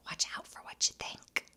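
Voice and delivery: hushed whispered warning; a soft whisper of caution. Acted. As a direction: lean in and whisper it like a secret. Warning viewers about thoughts.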